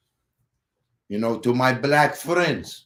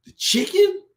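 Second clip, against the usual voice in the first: sudden high squeak on chicken; squeaky; 3 dB louder than usual. Pretend. Joking about cat versus chicken, squeaky high pitch. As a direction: jump way up on the chicken.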